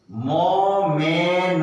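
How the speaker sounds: drawn out; slowly sounded out; slow syllable split. Shown as a demonstration. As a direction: stretch each part. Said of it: "slow demo".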